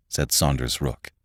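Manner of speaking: ordinary delivery, nothing marked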